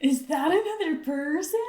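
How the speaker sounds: high, overdramatic questioning voice; mock-dramatic echo; mimicked Tanjiro impression. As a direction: mimic the hammed-up anime line, rising at the end. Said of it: "is that your best Tanjiro impression?".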